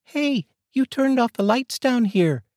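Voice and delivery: put-on high child's voice, slow, called up; boy yelling up from the basement. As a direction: shout up the stairs as a kid. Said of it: "David yell up from the basement".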